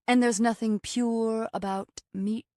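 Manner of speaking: Moonbliss, haughty. A narrator's reading. Raw vegan dismisses meat to her hostess, haughtily. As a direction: look down on meat as you say it. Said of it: "explained haughtily".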